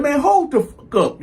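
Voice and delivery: high-pitched, agitated retold outburst; acting out an irritated outburst; angry retort. Retold cursing at the ushers.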